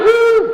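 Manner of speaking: high imitated gasp. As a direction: mimic the gasp he wants to stop. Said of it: "no inhaling".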